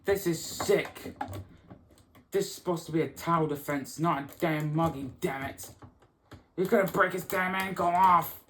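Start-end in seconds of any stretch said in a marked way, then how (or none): none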